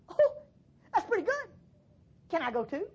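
eager, high burst of excitement; excited. Acted. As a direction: ask like an eager child wanting to come along. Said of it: "I get excited".

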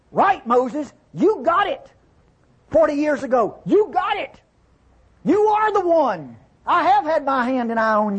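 high, lifted voice of God, affirming; God speaking to Moses. Acted. Preacher voicing God to Moses.

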